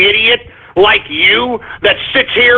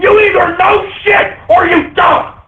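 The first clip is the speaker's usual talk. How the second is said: furious shout; 9 semitones higher than usual. Real, not acted. Host yelling at ignorant viewers, furious shout. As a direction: let the rage explode at full volume.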